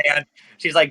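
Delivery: ordinary talk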